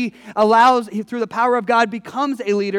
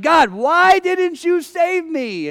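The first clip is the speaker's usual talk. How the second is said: indignant plea; 8 semitones higher, 5 dB louder, much slower than usual. Acted. Drowned man complains to God.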